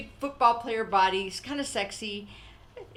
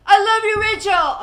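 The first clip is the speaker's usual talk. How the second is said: calls it out loud and much higher as he leaves; mock big farewell call, higher and louder; 11 semitones higher, 16 dB louder than usual. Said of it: "at the end, he leaves like".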